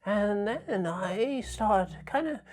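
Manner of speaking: high, slow, reedy and trembling; shaky old person voice. Shown as a demonstration. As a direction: shake the voice like a frail elder. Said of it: "reedy sort of shaky voice that might be for an old person".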